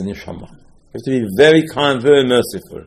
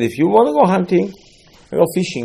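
higher indignant advice; higher indignant go fishing; scolding; 8 semitones higher, 3 dB louder, faster than usual. Acted. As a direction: scold him toward fishing. Scolding the would-be hunter.

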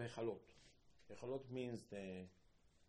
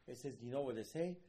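ordinary talk

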